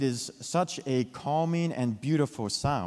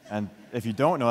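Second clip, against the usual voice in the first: plain reading voice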